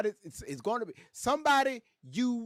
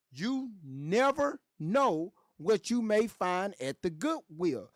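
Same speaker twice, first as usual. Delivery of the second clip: slow, deliberate, word by word; slow emphasis; slower than usual. Real, not acted. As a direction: draw out each word to drive it home. Repeats advice slowly for emphasis.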